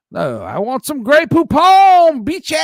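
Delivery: high, loud, drawn-out mock outburst; over-the-top comic demand; hammed-up yell. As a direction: build to a big high mock shout. Climax of the accent bit.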